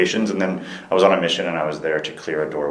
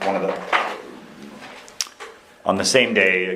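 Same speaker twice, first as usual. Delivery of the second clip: louder, slightly raised voice; speaking up; 3 semitones higher than usual. Real, not acted. Interviewee restarting for the interviewer, speaking up. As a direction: restart the account with more volume. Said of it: "speak a little louder".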